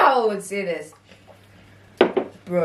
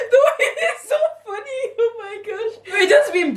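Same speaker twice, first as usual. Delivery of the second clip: talking through high giggles; cracking up, words squeezed high; laughing; 11 semitones higher than usual. Real, not acted. Daughter cracking up at mom.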